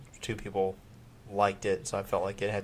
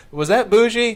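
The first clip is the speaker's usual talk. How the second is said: high loud teasing question; 12 semitones higher, 10 dB louder than usual. Retold or imitated. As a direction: tease her with it. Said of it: "I'll just say at odd moments".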